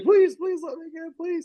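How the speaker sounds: high-pitched mock frantic begging; mock pleading. Grabbed woman begging to be let go, mock pleading. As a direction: beg like a panicked movie victim.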